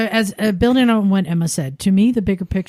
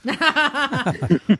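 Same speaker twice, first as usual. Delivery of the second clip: laugh; 5 semitones higher than usual. Real, not acted. Laughing at ten pence correction.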